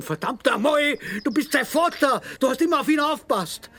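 a father yelling about his dead son at the man trying to calm him; furious shout. Acted. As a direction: scream the loss at him, building to a peak. Grieving father's furious outburst.

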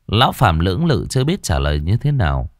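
ordinary delivery, nothing marked

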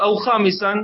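ordinary delivery

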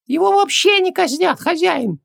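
very high, squeaky little genie, offended; Хубаксис. A narrator's reading. Genie butts into his master's conversation, offended. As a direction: pipe up indignantly in a tiny squeaky voice. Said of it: "обиженно вмешался в разговор Хубаксис".

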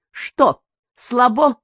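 taunting. A narrator's reading. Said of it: "нападала Таня".

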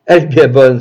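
sudden loud retort; indignant exclamation. A narrator's reading. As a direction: burst out with it. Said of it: "s'exclama-t-elle". (exclamation forte, indignée)

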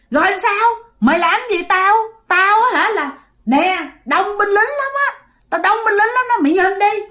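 put-on high, taunting ghost of an old woman; an old woman's ghost mocking the sorcerer; defiant ghost boasting. Ghost boasts of her soldiers. (giọng hồn ma bà già thách thức)

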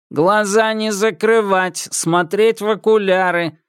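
commanding metallic voice. Acted. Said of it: "велел он".

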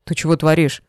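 ordinary delivery

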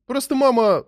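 high-pitched, flustered explanation; awkward boyish excuse; embarrassed son. A narrator's reading. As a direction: stumble to explain, pitch shooting up. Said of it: "окончательно смутился сын".

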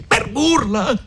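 high-pitched outraged question; indignant cry. A narrator's reading. Renzo flings Agnese's words back at her, indignant cry. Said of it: "Gridò Renzo".

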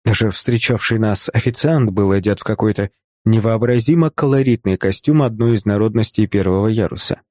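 plain reading voice

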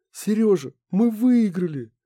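high, slow, excited call. Acted. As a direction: announce it with growing joy. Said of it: "сказала она уже увереннее".

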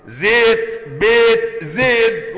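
زيت، بيت، زيد said with e vowels, loud and high; imala pronunciation. Shown as a demonstration. Teacher showing students the imala they already speak. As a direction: call out zēt bēt zēd with the vowel leaning to e. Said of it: "الإمالة أنت بتتكلمها".

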